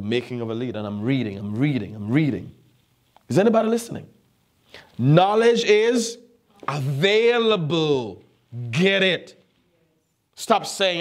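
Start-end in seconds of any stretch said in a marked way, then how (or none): 3.3-4.1 high, insistent check on the congregation; calling out. Real, not acted. 5.0-11.0 high, drawn-out proclamation; emphatic preaching. Real, not acted. Preacher drives home main point.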